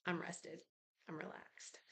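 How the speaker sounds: soft breathy whisper. Real, not acted. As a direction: barely voice it. Relaxed after yawning.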